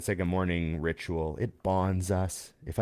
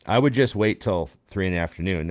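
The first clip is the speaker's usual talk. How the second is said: ordinary talk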